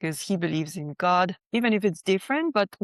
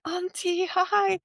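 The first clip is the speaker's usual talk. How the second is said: high-pitched call from below; 12 semitones higher, slower than usual. Retold or imitated. Calling up to Auntie on the veranda from downstairs, high and bright. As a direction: call her name up to the veranda. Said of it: "I shout her name from downstairs".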